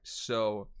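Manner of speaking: ordinary talk, nothing marked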